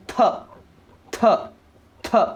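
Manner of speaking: th sounded as an aspirated "thuh", like थ; teacher spacing out each th sound; digraph sound demo. Shown as a demonstration. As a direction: repeat th as a short breath-released t syllable. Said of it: "combination is giving a sound".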